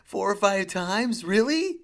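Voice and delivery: hammed-up astonishment at the bad parking; incredulous. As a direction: stretch "Four or five times" out slowly and high, the pitch jumping on "Really". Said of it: "I was like".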